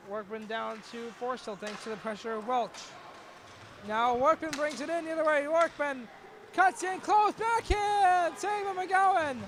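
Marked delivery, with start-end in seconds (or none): 3.9-9.4 higher and louder, peaking on the save; excited call. Real, not acted. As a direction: build to the shot, then hit the save. Breakaway and save.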